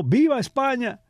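high-pitched imitated cheer; mock rallying cry, pitched well up; retold shout. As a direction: imitate a rival crying out a slogan. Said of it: "otra gritó".

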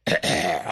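low throat-clear before speaking. Acted. Witness preparing a long account, throat clearing. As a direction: clear the throat ahead of a story.

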